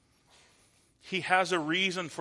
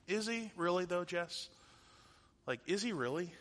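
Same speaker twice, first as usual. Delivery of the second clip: soft, doubting question; hushed, unconvinced voice; skeptical; 6 dB quieter than usual. Acted. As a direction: quietly question whether it is true. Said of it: "maybe your skepticism starts to kick in".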